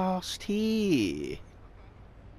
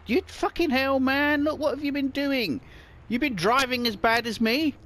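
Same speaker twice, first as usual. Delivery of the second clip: mock scolding; 8 semitones higher than usual. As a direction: scold him with a grin. Scolding another driver jokingly.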